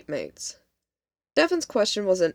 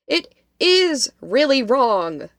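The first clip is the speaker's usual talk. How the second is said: high, loud and slow, leaning hard on IS; emphatic insistence; 7 semitones higher, 7 dB louder, slower than usual. A narrator's reading. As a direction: stretch IS out, insisting in distress. Upset friend contradicts, insisting it's serious.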